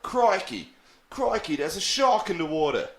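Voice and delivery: put-on Australian accent, quick; Steve Irwin. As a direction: exclaim it in a broad Aussie accent. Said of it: "I sounded like Steve Irwin there".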